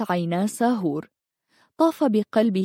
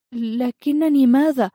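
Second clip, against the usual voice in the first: slow, higher-pitched, flustered question; startled confusion; 5 semitones higher, 3 dB louder than usual. A narrator's reading. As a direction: ask it taken aback, drawing the words out. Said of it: "أجفلت وسألته بارتباك". (بارتباك ودهشة)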